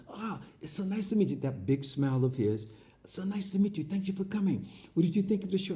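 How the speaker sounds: a smiling, welcoming host greeting a guest; Kansai. Retold or imitated. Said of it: "So Kansai walks over to me and says".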